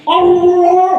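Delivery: high, loud drawn-out cry; mock outcry. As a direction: belt it out as a hammed-up cry. Comic imitated cry. (teriakan keras tinggi yang dibuat-buat)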